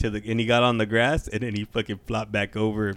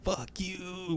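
faint, breathy dying curse; dying breath; 9 semitones higher, 13 dB quieter than usual. Retold or imitated. The stabbed squirrel cursing with its dying breath. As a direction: gasp it out like your last words. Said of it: "with his dying breath".

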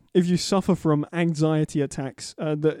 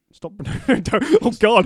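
laughing panic at own mistake; laughing in horror; 6 semitones higher, 7 dB louder than usual. Real, not acted. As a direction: crack up mid-sentence. Realizes the blunder, laughing.

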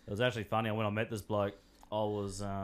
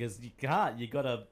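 usual speaking voice

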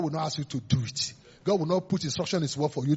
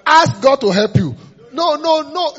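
loud, high, drawn-out exhortation; emphatic preaching shout; 10 semitones higher, 14 dB louder, slower than usual. Real, not acted. Preacher hammering point one to the congregation, emphatic shout. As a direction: belt out the command to the congregation.

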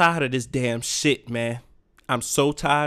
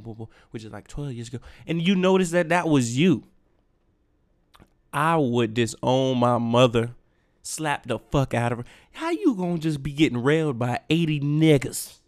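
ordinary talk, nothing marked

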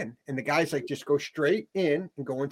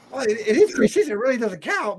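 mock convinced kids; 6 semitones higher than usual. Kids mimicking coach's lesson.

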